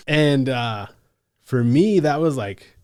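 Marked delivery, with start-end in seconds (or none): none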